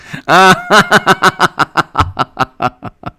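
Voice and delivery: high laugh trailing off; long, high burst of laughter that dies away. Real, not acted. Streamer cracking up on the live stream, high laughter.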